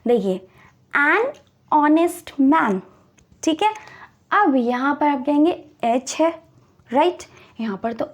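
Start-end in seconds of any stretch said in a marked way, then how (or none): 0.9-2.8 very slow, word by word; slow example read. Shown as a demonstration. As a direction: spell the phrase out slowly for learners. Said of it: "teacher reads example slowly".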